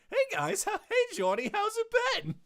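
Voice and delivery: very high, loud cartoon creature voice; high-pitched, hammed-up impersonation; Terriermon mimicking Meteormon. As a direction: squeak out a bad ventriloquist's impostor greeting. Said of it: "who could put on the best Meteormon accent".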